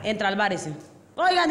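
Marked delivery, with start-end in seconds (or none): 1.2-1.5 high, sharp call for attention; mock police demand; angry policeman. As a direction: play an annoyed officer addressing the bar. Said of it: "dice el policía".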